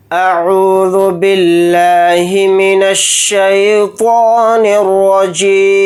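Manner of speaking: slow melodic tajweed chant; measured Quranic recitation. Shown as a demonstration. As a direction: recite slowly, stretching each vowel. Opens recitation with taawwuz.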